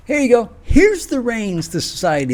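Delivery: sarcastic. Acted. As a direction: hand it over with fake generosity. Sarcastic handing over the reins.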